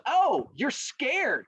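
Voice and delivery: high, drawn-out taunt. Acted. Turtle to rabbit refusing the race, taunting. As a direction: goad him, stretching the words.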